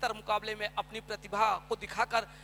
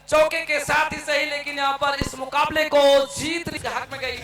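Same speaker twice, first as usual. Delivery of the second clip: excited commentary; 4 semitones higher, 9 dB louder than usual. Real, not acted. Match-winning boundary.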